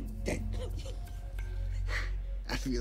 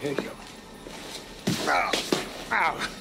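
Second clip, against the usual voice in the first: pained yelps; 10 semitones higher, 7 dB louder than usual. Acted. Hurt, crying out.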